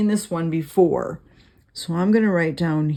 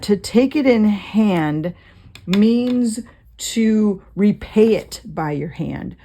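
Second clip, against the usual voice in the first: slow, deliberate explanation; 2 semitones higher, 3 dB louder, slower than usual. Shown as a demonstration. Teacher slowing down to explain the phrase, slow and deliberate. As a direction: spell out the meaning slowly, word by word. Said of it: "explaining the phrase slowly".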